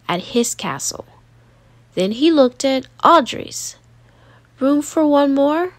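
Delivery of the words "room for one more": higher, gentle hopeful question; shy boy asking. Acted. Gideon asking his sister to join, hopeful. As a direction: ask to join humbly. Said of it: "he asked".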